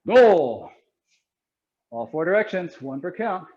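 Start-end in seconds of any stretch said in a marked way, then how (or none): 0.0-0.7 sharp martial arts commands. Acted. Karate class counting in Japanese.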